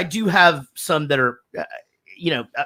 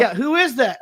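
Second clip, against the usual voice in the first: lightly voiced higher kid; nosy child; 6 semitones higher than usual. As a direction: press Dad like a curious child. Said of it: "All three of my children instantly".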